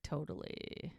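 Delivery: breathy whispered aside; a voiceless little deadpan agreement; hushed. Retold or imitated. Bree agreeing with Mike under her breath, hushed.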